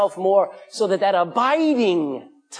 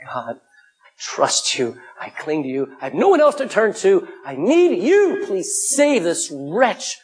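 acted plea to God, drawn-out words; imploring sinner's prayer, stretched for emphasis; desperate cry; slower than usual. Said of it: "If you have a desperate cry of".

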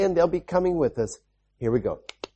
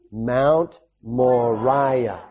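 slow group repeat; 3 semitones lower, much slower than usual. Shown as a demonstration. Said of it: "Can you say it with me?".

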